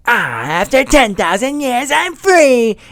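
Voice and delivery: high, loud villain cry of release; Rita Repulsa's famous line. Retold or imitated. Host quoting Rita Repulsa's famous line in a high shrieking villain voice. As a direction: shriek it out like a freed witch. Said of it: "she quotes her famous".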